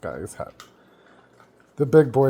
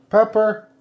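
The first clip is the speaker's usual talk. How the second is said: calling the dog; 10 semitones higher, 7 dB louder than usual. Real, not acted.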